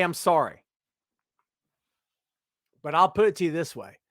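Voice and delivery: usual speaking voice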